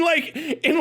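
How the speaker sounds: normal talking voice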